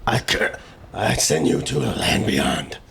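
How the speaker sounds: slow whispered witch curse. Acted. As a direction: hiss it like a witch. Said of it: "That's a witch style curse".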